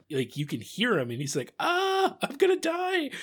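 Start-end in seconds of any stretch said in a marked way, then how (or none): 1.6-3.1 high-pitched, held-out mock wail; hammed-up cry of doom, pitched way up; mock dying scream. Podcaster imitates a doomed pilot, high mock death wail. Voices a pilot being destroyed.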